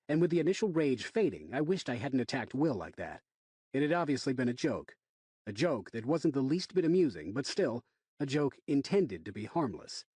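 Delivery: ordinary delivery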